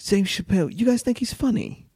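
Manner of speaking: high-pitched bad British accent. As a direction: play a badly imitated British skeptic. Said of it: "That was my worst British accent".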